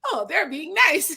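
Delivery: high-pitched innocent mimicry; naive American friend. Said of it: "but he's like".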